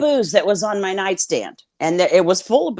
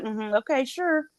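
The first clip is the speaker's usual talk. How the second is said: skeptical; 6 semitones higher, 8 dB quieter than usual. Retold or imitated. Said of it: "she's like".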